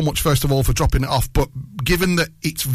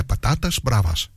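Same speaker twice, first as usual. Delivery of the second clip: Spanish accent; 5 semitones lower than usual. Shown as a demonstration. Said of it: "put a little bit of Spanish in there".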